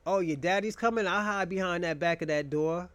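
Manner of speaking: ordinary talk, nothing marked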